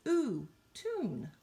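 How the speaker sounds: Ooh stretched out high before tune; long oo. Shown as a demonstration. Teacher chanting vowel sounds with the class, high and drawn. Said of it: "so we can hear those long vowel sounds".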